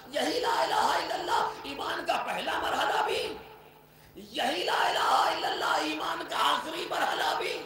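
loud, rising pulpit voice that keeps pressing; forceful preaching. Acted. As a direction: urge them on, step after step.

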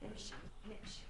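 hushed aside. Acted.

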